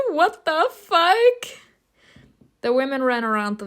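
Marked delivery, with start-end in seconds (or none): 0.1-1.6 very high, drawn-out disbelief; incredulous shock. Real, not acted. Player shocked by the village chase, high drawn-out disbelief. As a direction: squeal it slowly, rising at the end.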